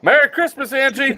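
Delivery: high, loud sarcastic holiday shout; mock shouted greeting. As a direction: yell it like a cranky mom. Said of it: "coming out of her window".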